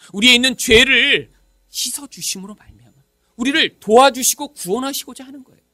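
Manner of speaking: normal talking voice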